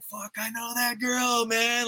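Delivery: drawn-out, high-pitched burst of amazed pride; excited recognition. Retold or imitated. Host recalling spotting a friend's company on the marquee, excited. Retold thrill at seeing friend's name.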